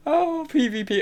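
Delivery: very high put-on voice; bad giggly-woman impression. Streamer mimicking a friend reacting to chat, high mock voice. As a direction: imitate her in an exaggerated high pitch. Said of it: "I can't do impressions".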